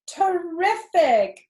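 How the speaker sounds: high, slow, breathed out in wonder; joyful admiration. A narrator's reading. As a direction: savour the word with delight. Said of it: "breathed Zuckerman in joyful admiration".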